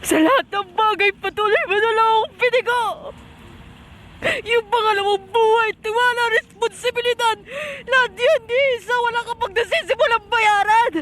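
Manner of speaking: desperate, tearful outburst; anguished self-berating. Acted. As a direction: berate yourself through anguish, urging yourself up. (naghihinagpis na panunumbat sa sarili)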